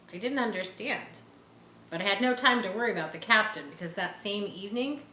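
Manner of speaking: usual speaking voice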